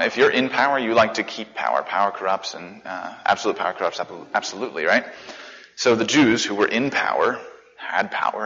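ordinary talk

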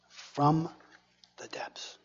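"From" rising, then "the depths" breathed in a whisper; hushed. Shown as a demonstration. Preacher hushing the key phrase for the congregation, whispered ending. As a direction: let "depths" fade to a whisper. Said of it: "Different preposition".

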